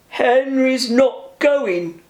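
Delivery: slow, haughty, pompous refusal; rude Gordon. Acted. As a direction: drag it out like a proud big engine. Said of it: "said Gordon rudely".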